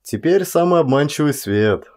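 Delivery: usual speaking voice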